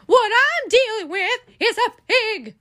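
high, loud rising disbelief; can't believe it's a pig; astonished pig reveal. Acted. Officer hearing the caller reports a pig, loud disbelief.

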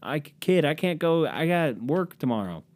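mildly pleading, stretched-out refusal; reluctant buddy. Acted. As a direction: slowly beg off from a friend's plan. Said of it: "And I'd be like".

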